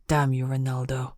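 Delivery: understated angry growl; low growl. A narrator's reading. Man pounding the cave wall at his absent brother, growling mildly. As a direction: curse him under restraint, kept low. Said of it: "I growled".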